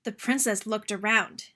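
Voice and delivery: ordinary delivery, nothing marked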